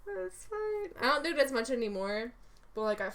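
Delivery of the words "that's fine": high, whimpery put-on voice of Ray; Ray's voice. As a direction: slip into the Ray character, half crying. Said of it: "I'd say a word like Ray would".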